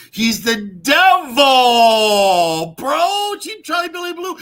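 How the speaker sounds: loud drawn-out proclamation; drawn-out shout. Real, not acted. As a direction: stretch and yell it. Shouting devil claim.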